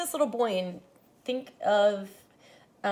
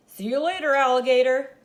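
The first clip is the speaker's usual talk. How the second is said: a higher, louder singsong goodnight call; Daddy calling; 4 semitones higher, 8 dB louder than usual. Acted. Daddy calls goodnight to his son from the hallway, playful call. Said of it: "Daddy called from the hallway".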